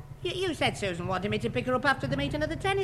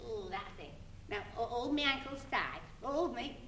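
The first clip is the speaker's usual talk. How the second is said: ordinary talk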